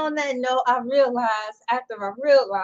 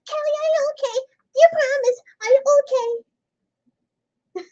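much higher and louder squeaky singing; chipmunk voice; 10 semitones higher, 5 dB louder than usual. Pretend. Mocking the chipmunk singers at the song's end, high and louder. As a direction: sing it like a sped-up chipmunk. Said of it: "start sounding like chipmunks".

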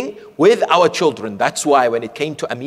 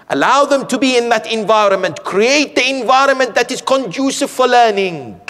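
fervent exhortation; 9 semitones higher, 4 dB louder than usual. Acted. Pulpit exhortation to parents.

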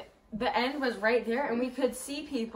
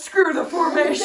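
loud, high mock yell; hammed-up panicked shout; imitated shouted retort; 3 semitones higher, 9 dB louder than usual. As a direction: holler it in rebellion. Imitating Lulu's shouted reply.

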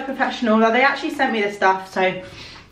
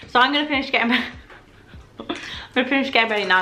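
lisping and laughing through strips; lisp from whitening strips; 3 semitones higher, faster than usual. Real, not acted. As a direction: speak round the strips, giggling. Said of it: "now I have a massive lisp".

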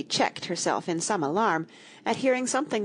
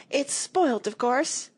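high-pitched, upset complaint; petulant, high lament; outraged lament; 7 semitones higher, slower than usual. A narrator's reading. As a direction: wail it in a put-on high voice. Tweedledum raging over broken rattle.

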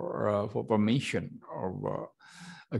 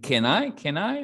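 can I with a strong rise, higher on the second; rising question tune; 9 semitones higher, 5 dB louder than usual. Shown as a demonstration. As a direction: lift the end of can I. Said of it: "Like that".

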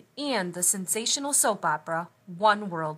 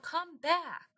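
calling out; 9 semitones higher than usual. Acted. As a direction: plead for the runaway to return. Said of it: "Mister Sims called out".

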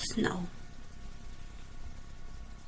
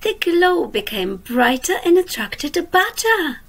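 lively storybook reading for little ones; reading to children; 5 dB louder than usual. A narrator's reading. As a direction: read it like a bedtime picture book. Reads a picture book aloud.

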